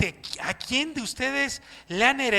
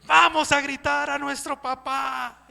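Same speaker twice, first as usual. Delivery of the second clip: worship leader call; 4 semitones higher than usual. Retold or imitated. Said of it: "estamos en la adoración".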